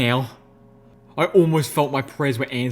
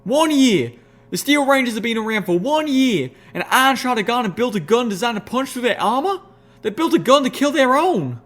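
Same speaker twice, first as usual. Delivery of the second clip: much higher, louder furious tirade; furious outburst; 11 semitones higher, 5 dB louder than usual. A narrator's reading. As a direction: spit it out in fury. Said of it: "let out in fury".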